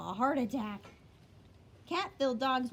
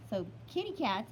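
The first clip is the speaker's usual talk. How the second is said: ordinary delivery, nothing marked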